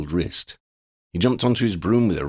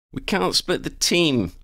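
high, slow warning; 11 semitones higher than usual. Acted. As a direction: hold them back firmly. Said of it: "Lydia warned".

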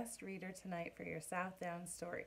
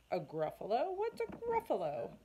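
much higher, shrieked question; squawking puzzled owl; 6 semitones higher, 4 dB louder than usual. Acted. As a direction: owl asking the mouse, startled.

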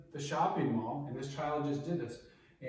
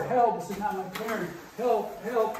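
raised, higher call for help; demonstrated yell for help; 6 semitones higher, 5 dB louder than usual. Shown as a demonstration. Coach acting out child clinging to the floor, raised yell. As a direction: call out for help over a struggle. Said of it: "continue to yell".

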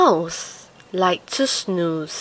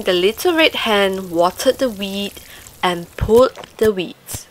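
ordinary delivery, nothing marked

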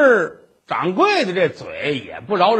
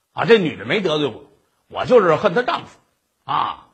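ordinary delivery

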